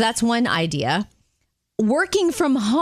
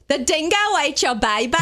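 a light mock Australian accent; a quick jokey Aussie line; Seinfeld Australian impression; 5 semitones higher than usual. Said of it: "is Seinfeld".

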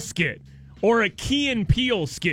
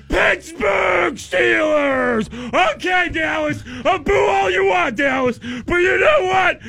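sneering wrestling villain; 3 semitones higher, 3 dB louder than usual. Retold or imitated. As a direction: taunt the booing crowd like a heel. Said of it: "they just parade him out there".

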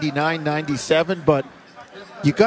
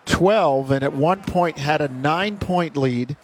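brisk live game call; play-by-play. Acted. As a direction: call the action as it happens.

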